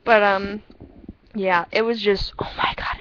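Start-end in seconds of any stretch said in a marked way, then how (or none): none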